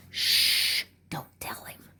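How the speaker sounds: mock-secretive hushed whisper; conspiratorial whisper. Vlogger lets viewers in on a secret from the boss, conspiratorial whisper. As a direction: shush them, then whisper the secret.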